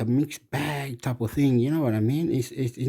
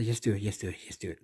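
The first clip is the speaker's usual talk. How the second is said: urging, dropping softer to near silence; fading urging; 4 semitones lower, 10 dB quieter, much faster than usual. Real, not acted. Urging Hector to just do it, fading to a murmur. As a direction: trail off as you keep urging.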